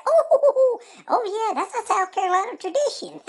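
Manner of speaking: cackling high through the joke; laughing. Real, not acted. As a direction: laugh at the rival while talking. Laughing at rival's jail story.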